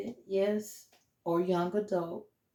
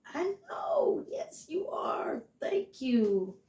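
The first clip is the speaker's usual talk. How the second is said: high, teary half-whisper; mock crying with pride; breaking into a whispered sob; choked-up reply; 8 semitones higher, much slower than usual. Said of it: "And I'm like".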